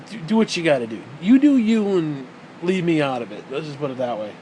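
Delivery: usual speaking voice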